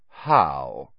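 low, cold drawling sneer. A narrator's reading. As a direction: drawl it out coldly. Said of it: "the cold, drawling voice of Draco Malfoy".

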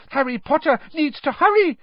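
urgent high elf squeak; very high-pitched frantic elf; Dobby. A narrator's reading. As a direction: squeak it out in a hurry. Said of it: "squeaked Dobby".

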